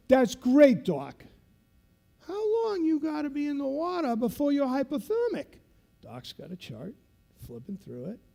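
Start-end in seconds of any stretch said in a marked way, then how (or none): 0.1-5.6 high, loud showman quiz; retold instructor hamming it up; megaphone instructor. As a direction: play it up for the trainees. Instructor quizzing doc on megaphone.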